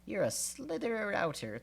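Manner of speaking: high, mocking jab; mocking taunt. Sophie's taunt.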